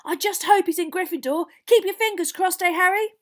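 put-on loud, high, eager boy; bouncing excitement. Boy begging his hero for luck, excited. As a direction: gush it breathlessly to your idol. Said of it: "practically bouncing up and down".

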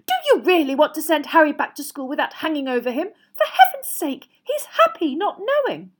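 loud, high pleading protest; climbing, exasperated appeal; shrill. A narrator's reading. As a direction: shrilly argue with a husband. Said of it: "said Missus Weasley shrilly".

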